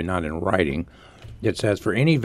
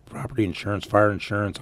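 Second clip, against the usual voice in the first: usual speaking voice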